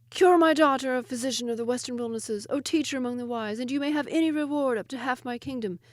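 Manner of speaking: high, loud royal plea; grieving king begging for a cure; desperate king. Acted. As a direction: cry out to the physician, pleading. King of Ind begs.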